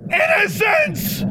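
long high scream stretched slow; desperate slow scream of her name; drawn-out scream. A narrator's reading. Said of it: "Alexandra screamed".

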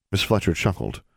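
usual speaking voice

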